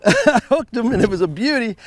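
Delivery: high, loud laughing pride; laughing excitement. Real, not acted. Angler laughing to viewers about hooking the walleye, excited.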